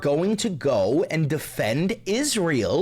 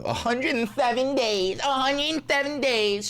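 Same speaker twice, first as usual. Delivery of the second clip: higher, lightly voiced mock impression; mocking Kamala Harris; 7 semitones higher than usual. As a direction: parrot her book line in a mildly mocking tone. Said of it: "Kamala Harris has been going on this tour".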